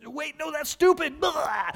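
high, loud mock shout ending in a blurt; panicked blurting. Preacher acting out words escaping before he can think, frantic blurting. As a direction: try to stop the words as they burst out. Acts failing to stop blurting.

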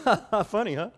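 loud, high fake laugh; passive-aggressive joking. Preacher playing someone pulling the poker back out, passive-aggressive. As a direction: laugh off the jab with mock cheer. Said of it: "That's so passive-aggressive".

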